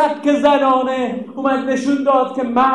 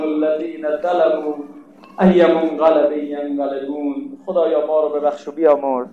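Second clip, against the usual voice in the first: closing prayer; 10 semitones lower than usual. Acted. Preacher leading the closing prayer for the mourners, low and humble. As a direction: plead humbly with God.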